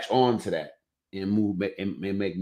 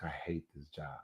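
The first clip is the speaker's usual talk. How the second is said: low, very soft grumble; weary grumble; 5 semitones lower, 18 dB quieter than usual. Retold or imitated. Telling how he came home every day, low grumble. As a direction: mutter it under your breath, a retold daily complaint. Retelling his daily complaint.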